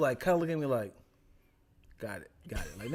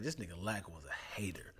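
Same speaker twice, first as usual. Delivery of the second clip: unvoiced whispered gossip; 8 semitones lower, 14 dB quieter than usual. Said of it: "my friends to this day was like".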